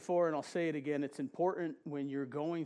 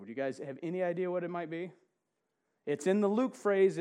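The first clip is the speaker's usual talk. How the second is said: normal talking voice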